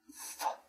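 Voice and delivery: a soft breathy "f"; f sound. Shown as a demonstration. Teacher demonstrating the f sound of P H. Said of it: "the f".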